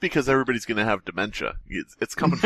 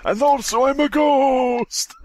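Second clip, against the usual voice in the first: mock ghost shout; 11 semitones higher, slower than usual. Friend joking about being old, shouting high as a ghost. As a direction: ham up a spooky old ghost. Hamming up old-timer bit.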